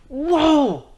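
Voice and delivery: normal talking voice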